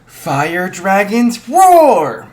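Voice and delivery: slow build into a huge high yell on roar; dragon roar. Retold or imitated. Izuku roaring his fire at Igneel, full-out yell. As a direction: throw everything into the battle cry. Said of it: "and he goes".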